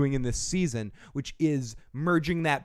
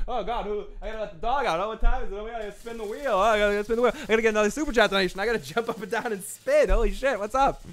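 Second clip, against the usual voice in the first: higher, hurried nervous voice; scatterbrained panicky self-talk; frantic inner voice; 6 semitones higher, faster than usual. Retold or imitated. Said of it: "I'm thinking about".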